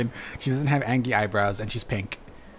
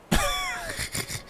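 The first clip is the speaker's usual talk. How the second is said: high squeal of laughter. Real, not acted. Laughs at her own joke.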